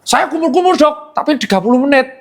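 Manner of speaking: high-pitched cheeky patient; mock naughty patient. Naughty patient pleads a loophole to the doctor, mock cheeky. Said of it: "ini kan ada yang nakal".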